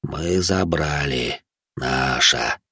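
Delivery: slow, low rustling ghoul; ghoul objecting. A narrator's reading. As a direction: squeeze the words out slowly, deep and hushed. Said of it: "возразил Гуль". (гуль возражает низко, медленно, с шелестом)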